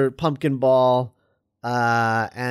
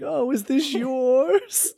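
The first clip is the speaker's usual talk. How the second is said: high, slow, wavering put-on granny; old lady persona; 6 semitones higher, 4 dB quieter, slower than usual. Goblin dressed as old lady meeting babies, high slow granny voice. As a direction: coo it slowly like a pretend old lady. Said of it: "just being like".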